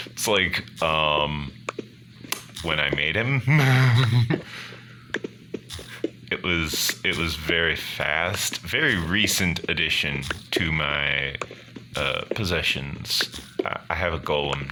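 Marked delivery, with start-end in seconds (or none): none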